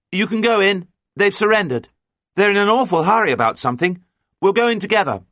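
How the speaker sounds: high, loud, carrying voice; shouting across. A narrator's reading. Captain tells the mate of the surrender, shouting. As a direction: shout the news over to the other boat. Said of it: "shouted John".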